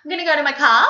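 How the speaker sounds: "I'm gonna go to my car" rising at the end like a question; upward intonation. Shown as a demonstration. Aussie demonstrating her countrymen's habit, upward intonation. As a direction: lilt upward to CAR as if asking. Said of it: "always with the upward intonations".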